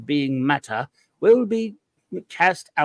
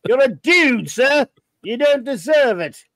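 louder, higher mock posh English butler accent; Jeeves accent; 7 semitones higher, 5 dB louder than usual. Host tells listener Max he is just a dude, Jeeves accent. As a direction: scold him grandly in a Jeeves accent. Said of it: "my annoyingly Jeeves accent".

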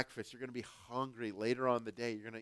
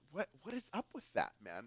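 quoting a friend; 4 semitones higher, 6 dB quieter, faster than usual. Retold or imitated. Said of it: "He's like".

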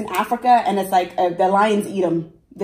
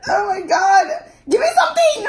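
fake panic; 10 semitones higher than usual. Prankster fakes panic over blood in her mouth. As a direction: play up the fright to sell the prank. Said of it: "I pranked you".

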